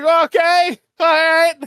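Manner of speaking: high, loud "Okay, all right" through laughter; laughing shout. Retold or imitated. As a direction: lose it while trying to agree. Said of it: "I was just like".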